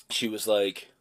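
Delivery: ordinary talk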